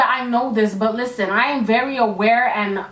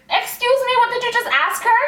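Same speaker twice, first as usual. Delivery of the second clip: offended mother; 12 semitones higher than usual. Retold or imitated. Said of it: "So my mother was like".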